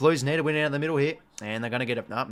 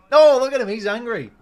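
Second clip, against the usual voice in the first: much higher and louder, thrilled disbelief; real excited cry at the screen; excited outburst; 9 semitones higher, 12 dB louder than usual. Real, not acted. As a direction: yelp it out like you can't believe your eyes. Player shouting angrily on screen.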